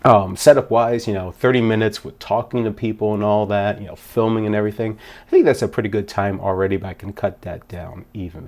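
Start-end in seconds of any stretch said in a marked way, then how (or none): none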